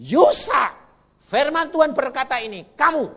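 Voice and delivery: loud, high command to yourself. Shown as a demonstration. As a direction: order your own soul, loudly. Preacher voices speaking to himself. (menegur diri sendiri dengan suara keras)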